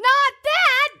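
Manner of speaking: very high-pitched indignant cry; exasperated retort. Acted. Princess answering Tangerine's defence of the spell, exasperated. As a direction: squeal back in exasperation. Acted quarrel, answers back.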